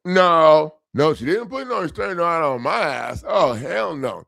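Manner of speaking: loud, high hell no; flat refusal, voice punched up; retold defiant outburst that fades back down; indignant refusal. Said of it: "I go".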